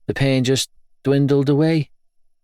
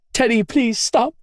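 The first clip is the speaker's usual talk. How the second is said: pleading mother; 12 semitones higher than usual. Acted.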